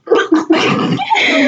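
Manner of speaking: high shrieking hag cackle; witch laugh. Pretend. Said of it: "You want it to be more like".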